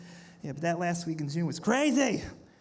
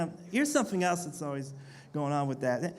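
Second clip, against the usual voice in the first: usual speaking voice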